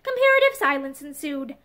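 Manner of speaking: usual speaking voice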